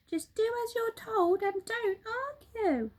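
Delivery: high, bright-pitched excited monkey voice; Mugglewump the monkey. Acted. Monkey father showing birds the living room, high excited voice. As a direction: chatter it out high and quick like an eager monkey.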